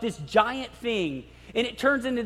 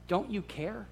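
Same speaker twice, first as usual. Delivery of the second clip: much softer, hushed question; quiet plea; 10 dB quieter than usual. Retold or imitated. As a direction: murmur it in doubt. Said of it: "they said".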